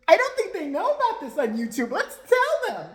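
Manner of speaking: high, loud, slowing mock-sweet mom voice; hammed-up imitation of a vlogger mom, dragging out the end. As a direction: sneer out her words like a smug influencer mom. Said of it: "you know what she said?".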